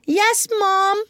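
high shouted chorus; eager chorus answer; children shouting. A narrator's reading. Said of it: "shouted in chorus".

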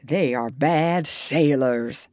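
grave dog Jip. A narrator's reading. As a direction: warn of the pirates gravely. Jip warns of pirates.

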